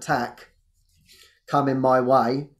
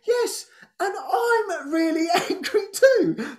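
laughing quote; slower than usual. Retold or imitated. Retold group member's line.

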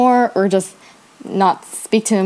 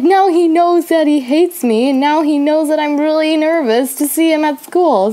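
high-pitched, worried rebuttal; retold upset protest; 6 semitones higher than usual. Teller answering the friend's reassurance, upset and worried. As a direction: argue back high and fretful.

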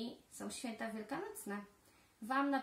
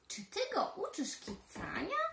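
surprised question; 5 semitones higher than usual. Acted. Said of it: "zapytały zdziwione".

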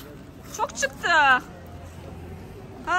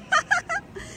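high laugh; 11 semitones higher, 3 dB quieter than usual. Real, not acted. Laughing at smoke.

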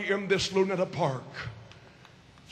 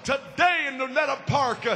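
high-pitched, driving revival sermon; full-force preaching; 6 semitones higher than usual. Real, not acted. Preacher urging the audience to receive the Holy Ghost, full-force preaching.

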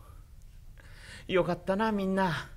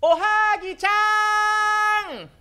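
calling out; 7 dB louder, slower than usual. Acted. Calling out to Ohagi.

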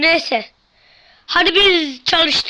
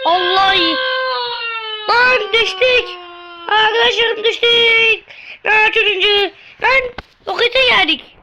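crying out in panic; 4 semitones higher than usual. Real, not acted. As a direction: wail as everything crashes. Crashing, crying out.

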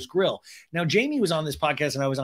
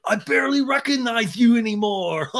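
high, hammed-up excited greeting with fake laugh; mock-hearty excitement ending in a forced guffaw; excited and gruff; 7 semitones higher, 4 dB louder than usual. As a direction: imitate an overexcited guy, pushing the voice up and out. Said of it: "really excited and gruff".

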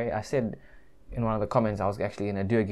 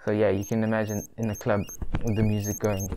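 cloth over the mouth; laughing behind a mask; through a face mask. Real, not acted. Seen 4: Cloth LED mask covers mouth and nose throughout; absent in references.

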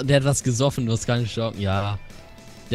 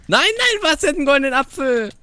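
high, alarmed outcry; alarmed disbelief; 9 semitones higher than usual. Real, not acted. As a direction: exclaim in real disbelief. Sees enemy with golden apple.

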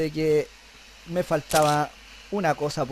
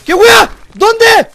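very high, very loud panicked shout; real startled scream; 18 dB louder, much slower than usual. Real, not acted. Player jumped by something in the game, panicked scream. Sudden scare in horror game.

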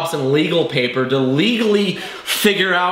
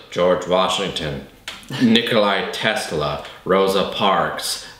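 a hint of a deep rambling Hopkins; lightly voiced Anthony Hopkins; 7 semitones lower than usual. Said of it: "He goes".

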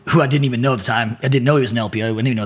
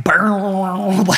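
higher-pitched rolled strum noise; imitated guitar stopping; 6 semitones higher than usual. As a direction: mouth a guitar strum cut short. Imitates guitar playing breaking off.

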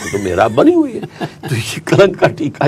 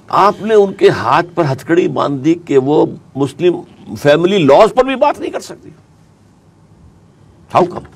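retold protest, higher-pitched and emphatic; indignant challenge; 9 semitones higher, 4 dB louder than usual. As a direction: confront him with an incredulous objection. Retells confronting the ruler with objection. (पुरज़ोर एतराज़ भरा लहजा)

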